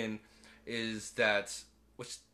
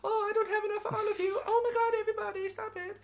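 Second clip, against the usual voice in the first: much higher and quicker, flustered; overwhelmed girl voice; faster than usual. Retold or imitated. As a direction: squeal it as they swarm you.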